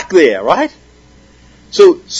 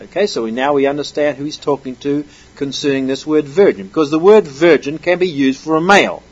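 normal talking voice

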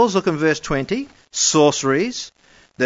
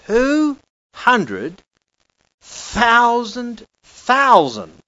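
two hundred thousand thousand drawn out slowly, higher; emphatic; 7 semitones higher, much slower than usual. Shown as a demonstration. Preacher rereads the number slowly in awe. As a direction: stretch out the huge number in awe. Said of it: "the number of the army of the horsemen".

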